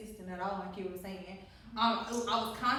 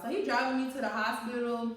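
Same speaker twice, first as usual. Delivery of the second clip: ordinary talk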